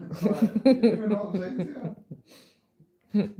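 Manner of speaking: laughing exasperation. Real, not acted. Friend laughing at Americans who miss the swearing, loud disbelief.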